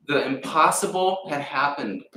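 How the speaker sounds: normal talking voice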